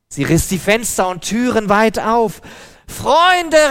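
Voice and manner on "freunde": very high, loud call for help. A narrator's reading. Woman flinging open the windows calls her friends loudly. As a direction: call out to the neighbours across the street. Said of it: "rief sie".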